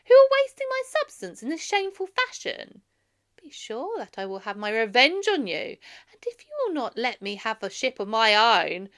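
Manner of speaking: loud, high, indignant refusal; young prince furious at the suitors; angry defiance. Acted. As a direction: throw it back at them with rising fury. Telemachus angrily refusing the suitors.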